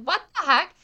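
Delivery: a sudden mock yelp of alarm; startled outburst. Thinks she is being pushed.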